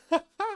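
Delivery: sudden squeaky laugh; high burst of laughter. Real, not acted. Laughing at own mispronunciation.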